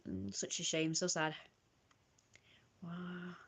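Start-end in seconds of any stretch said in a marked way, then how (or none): 2.8-3.4 breathy under-the-breath wow; barely voiced, to herself; whispered wow. Real, not acted. Reacting to her own old journal entry, whispered.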